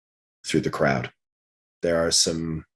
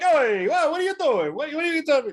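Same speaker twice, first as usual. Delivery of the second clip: put-on offended New Yorker accent. Devils in the audience take offence at the paladin, in a high New Yorker accent. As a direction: squawk it like a New Yorker insulted on his own block. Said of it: "like, super offended New Yorker accent".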